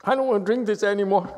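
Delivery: mock sulk. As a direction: pout like a hurt child, as a joke. Mock sulking after the yawn.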